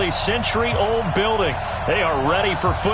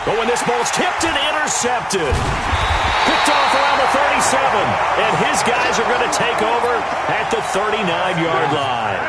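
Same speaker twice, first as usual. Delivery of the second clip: excited play call; 5 semitones higher than usual. Acted. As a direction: erupt as the ball is picked off. Tipped ball intercepted live.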